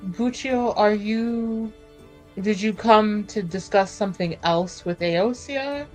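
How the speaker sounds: usual speaking voice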